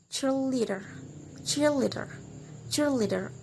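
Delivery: "cheerleader" with the weight on "cheer-"; first-syllable stress. Shown as a demonstration. Teacher repeats an example word, stressing "cheer-" in "cheerleader". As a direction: stress CHEER and let "-leader" fall away. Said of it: "we put the stress in the first syllable".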